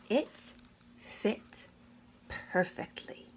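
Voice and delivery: breathy whispered reveal; soft whispered wonder. A narrator's reading. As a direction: hush it like a secret. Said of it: "whispered ending".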